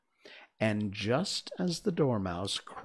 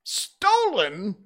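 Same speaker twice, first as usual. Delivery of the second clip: loud, high accusing cry; high, ringing outburst; exclaiming; 11 semitones higher, 8 dB louder than usual. A narrator's reading. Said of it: "the king exclaimed".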